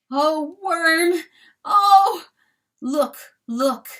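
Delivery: high, loud cries of grief; anguished outcry. A narrator's reading. Rooster crying out for his dead friend, high and loud. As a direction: call out in sudden anguish.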